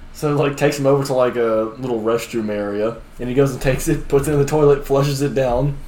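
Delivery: usual speaking voice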